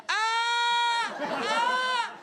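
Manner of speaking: high screaming yell of mock pain. Stung newcomer yelling across the court, hammed-up screaming. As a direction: scream it at the top of your lungs. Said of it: "shouted from the top of my lungs".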